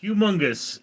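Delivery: "Humongous" as one word, opening on a "hyu" sound; corrected pronunciation. Shown as a demonstration. Streamer reading out a chat correction, careful pronunciation. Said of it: "It's pronounced Hugh".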